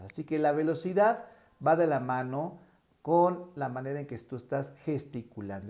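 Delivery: normal talking voice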